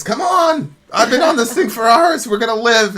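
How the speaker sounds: high, loud urgent call. Retold or imitated. Man on the log calling the stranded guy over, loud and high. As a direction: yell to him across the water.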